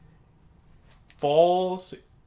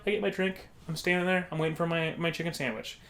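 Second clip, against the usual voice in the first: normal talking voice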